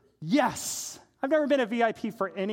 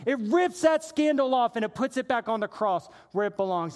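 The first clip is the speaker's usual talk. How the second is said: preaching; 6 semitones higher, 5 dB louder than usual. Acted. Preacher crying out to the congregation, loud and high. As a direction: preach it out with full force.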